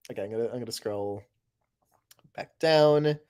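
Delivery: ordinary talk, nothing marked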